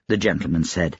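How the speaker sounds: ordinary delivery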